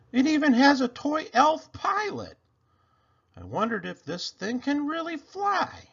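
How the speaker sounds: put-on high, loud delighted kid voice; a kid thrilled by his present; excited boy. A narrator's reading. Boy opening his present, high excited kid voice.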